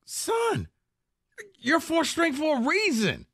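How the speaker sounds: high-pitched imitation of an exasperated coach; mock incredulous retort. Coach's retort to player, acted.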